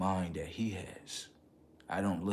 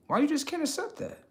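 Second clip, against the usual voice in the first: very high, rising disbelief; incredulous squeak. Real, not acted. Questioning harassers again, very high incredulous. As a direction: push it up in disbelief.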